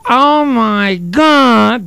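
high, slow, drawn-out gasp of Oh my God; Sandy Dennis impression. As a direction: stretch it out breathlessly like a stunned starlet. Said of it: "nobody did ... better than Sandy Dennis".

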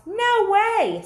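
high, loud flat no; loud refusal. Acted. Farmer refusing the cows' demand, loud and high. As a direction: bark out the refusal. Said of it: "said Farmer Brown".

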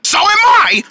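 high, loud retort; over-loud insistence; talking loud. Acted. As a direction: blurt it back loudly. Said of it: "you're talking loud".

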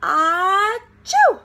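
a big acted sneeze with the words in it; hammed-up sneeze; loud sneeze. A narrator's reading. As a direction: burst out in a sneezy achoo. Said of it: "half sneezed".

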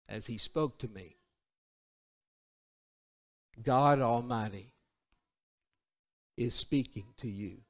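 very low, soft, halting phrases; hushed reminiscence. Real, not acted. Preacher remembering great-grandfather's breath, hushed and reverent. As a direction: recall it quietly, leaving long pauses.

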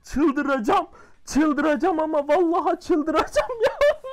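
high, loud yelling in real frustration; losing his mind at the game, screaming; frustrated shout. Real, not acted. Gamer yelling at his own bad luck, furious and loud.

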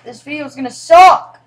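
loud wail rising at the end; exasperated shout. Real, not acted. Streamer despairs of the video to viewers, exasperated shout. Wails about the recording going badly.

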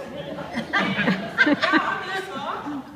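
hammed-up loud bluff; bluffing impostor. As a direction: overdo the claim to fool him. Jacob bluffs that he is Esau.

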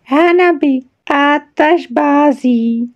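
usual speaking voice

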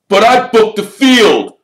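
loud, high furious protest; enraged, high-pitched objection; spitting with rage. A narrator's reading. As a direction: spit it out in a rage. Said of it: "positively spitting with rage".

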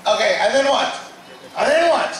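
high, insistent questioning, rising in force; impatient demand. Retold or imitated. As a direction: imitate listeners pressing for the rest. Eager listeners pressing for more.

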